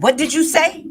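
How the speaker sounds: high, loud challenge from a boss running up; confrontational yell. Retold or imitated. Boss confronting the daughter with a high, loud yell. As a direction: bark it like she's stepping up to fight. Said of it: "that lady ran up on that counter so fast".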